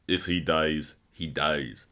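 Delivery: low, bad Russian accent; Ivan Drago. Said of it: "that was a bad accent".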